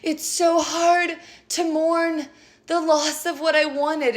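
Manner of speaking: high, slow, drawn-out self-pity in a put-on voice; mocking clients who whine about what they lost; whiny mock lament. Tarot reader mocking her audience, high and slow self-pity. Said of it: "all of you out there, like".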